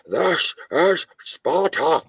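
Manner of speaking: slow, high, drawn-out movie shout; Gerard Butler Sparta line. Retold or imitated. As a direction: boom it out like a Spartan king. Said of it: "In, uh".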